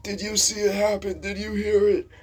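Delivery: high, loud mock interrogation; Mister Will. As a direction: press for answers as a worked-up old teacher. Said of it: "he's like".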